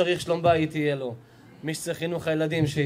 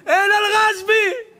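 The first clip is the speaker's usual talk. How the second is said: high, loud, drawn-out whoop; acted jubilant cheer; 14 semitones higher, 7 dB louder, slower than usual. Storyteller as delighted daughters-in-law praising Rashbi, jubilant cheer. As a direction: shout it out in mock celebration.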